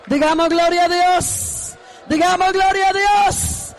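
rally the church into a rising shout; building praise shout. Acted. Preacher leading the congregation to shout glory to God, building.

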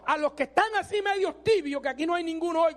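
ordinary talk, nothing marked

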